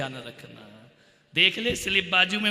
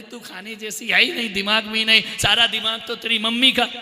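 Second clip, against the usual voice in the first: loud insulting retort; mock angry father; 2 semitones higher, 8 dB louder than usual. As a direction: throw the jibe back. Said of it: "अरे बोले".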